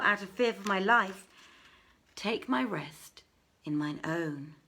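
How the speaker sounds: ordinary delivery, nothing marked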